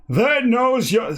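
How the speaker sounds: put-on high, roaring Guernsey accent; mock Guernsey roar. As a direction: bellow it in the only accent you can do. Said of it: "that's the only accent I can do".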